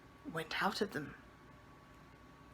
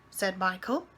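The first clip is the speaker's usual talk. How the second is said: ordinary delivery, nothing marked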